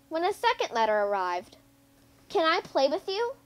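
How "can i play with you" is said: lifted, hopeful question; asking brightly. A narrator's reading. Small letter asking the first letter to play, hopeful. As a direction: ask sweetly to join in. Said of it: "the second letter asked".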